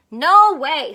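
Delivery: loud flat refusal; Farmer Brown shouting. Acted. Farmer refuses the cows, loud. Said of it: "said Farmer Brown".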